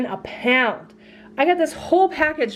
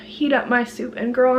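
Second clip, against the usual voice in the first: ordinary talk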